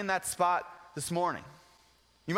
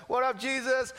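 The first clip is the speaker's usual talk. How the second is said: casual greeting; 6 semitones higher than usual. Retold or imitated. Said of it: "You imagine just like".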